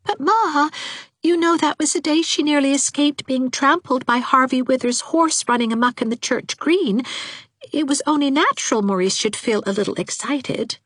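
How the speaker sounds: high-pitched, placating daughter voice; timid daughter making excuses, pitched high; meek pleading daughter. A narrator's reading. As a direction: plead gently with a difficult mother.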